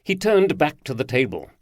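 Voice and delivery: ordinary delivery, nothing marked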